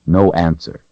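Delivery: ordinary delivery, nothing marked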